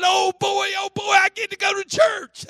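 high, shouted imitation of an overjoyed boy; excited. Willie gets permission from mama, shouting with excitement. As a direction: whoop it like a thrilled kid. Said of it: "he was all excited".

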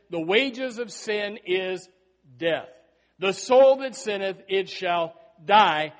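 preacher pronouncing the law, slow and loud; solemn scripture quote. Acted. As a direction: lay down the law of sin slowly.

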